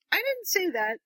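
high-pitched retold objection; mock protest. He objects to Ginny's assumption, lightly acted protest. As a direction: imitate him protesting, pitch jumping up.

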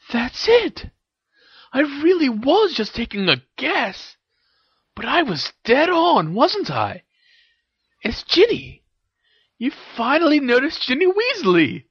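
high-pitched gleeful discovery; amused delight. A narrator's reading. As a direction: crow over being right, voice up high. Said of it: "she grinned in amusement".

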